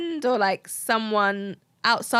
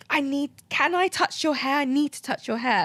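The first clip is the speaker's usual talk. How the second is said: higher-pitched, mildly urgent mimicry; urgent plea; 5 semitones higher than usual. Stranger on the tube begging to touch her hair, urgent plea. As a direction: imitate an over-eager stranger asking.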